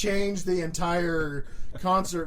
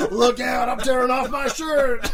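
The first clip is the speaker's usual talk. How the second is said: raised, higher, excited mock shout; hammed-up wild yell; 5 semitones higher, 3 dB louder than usual. Friend mimics a headbanger tearing off his shirt, mock yell. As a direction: call out over the music, half laughing. Acting out Brad's wild dancing.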